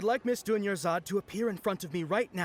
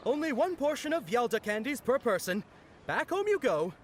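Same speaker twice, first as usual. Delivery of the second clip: mock stern; 7 semitones higher than usual. Scolding the greedy kid.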